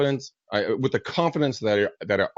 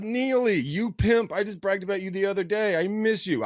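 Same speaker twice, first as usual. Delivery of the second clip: much higher, delighted shout-out; excited greeting; 10 semitones higher, slower than usual. Real, not acted. Guest greeting a friend watching live, delighted and higher.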